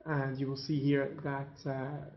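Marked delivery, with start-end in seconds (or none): none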